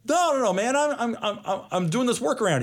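high-pitched, stammering protest; mock-defensive, pitched well up; retold protest. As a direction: wave off the warning with quick high no's. Retold protest to warning buddy.

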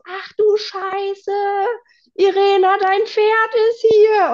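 high, loud, drawn-out yell; imitated scream. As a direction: yell the alarm across a field, stretched out. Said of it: "schreit auch Cindy auf einmal so".

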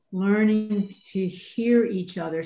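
usual speaking voice

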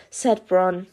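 plain reading voice

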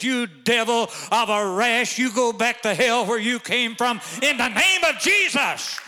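loud, high, slow commanding shout at the devil; angry rebuke. Retold or imitated. Daughter commanding the rash back to hell, furious shout. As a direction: bellow it like casting out a demon.

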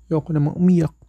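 ordinary talk, nothing marked

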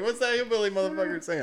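loud high girlfriend imitation; mock girlfriend. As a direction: play her, fed up and high. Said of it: "She's like".

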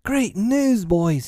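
high, eager announcement; excited cry. A narrator's reading. Peter bringing tidings to his boys, excited cry. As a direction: proclaim good news, pitched high. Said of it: "he cried".

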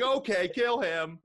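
high, slow, calm command to kill; mock villain order. Villain tells his henchman to kill Harry, slow and high. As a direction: give the order coolly, stretched out.

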